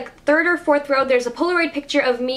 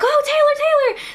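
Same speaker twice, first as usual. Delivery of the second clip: shrill, much higher, louder fan squeal; screaming fans; 3 dB louder than usual. Retold or imitated. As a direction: squeal her name like a starstruck fan. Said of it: "a crowd of fans being like".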